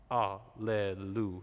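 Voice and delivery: alleluia with a punched onset on the first ah; slow alleluia, separated, accented start; accented ah vowel. Shown as a demonstration. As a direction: hit the ah of alleluia with a glottal accent. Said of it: "accent on that vowel".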